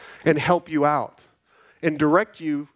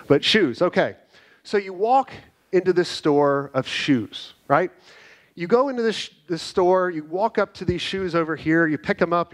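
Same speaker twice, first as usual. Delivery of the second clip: ordinary delivery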